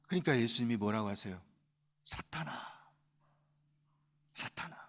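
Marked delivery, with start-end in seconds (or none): 2.0-4.8 quiet rebuke. Acted. Jesus rebukes Satan.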